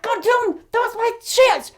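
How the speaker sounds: high, exasperated curse; frustrated outburst. Retold or imitated. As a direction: vent the lost chance. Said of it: "I was so pissed off".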